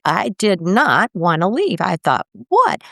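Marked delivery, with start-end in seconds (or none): 2.5-2.8 shocked what. Retold or imitated. Retold shock at waking up.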